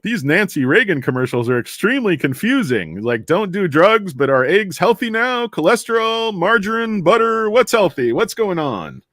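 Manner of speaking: mock bewilderment. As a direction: rattle off questions, playing baffled. Acted bewildered viewer of drug ads.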